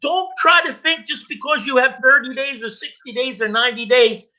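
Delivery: high-pitched, emphatic warning to newcomers; impassioned recovery preaching. Real, not acted. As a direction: drive the hard truth home, insistently. Exhorts audience directly.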